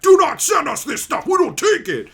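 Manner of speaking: high, loud scolding store voice; mock stern shout. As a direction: bark a refusal like an annoyed clerk. Buylist store rejecting card.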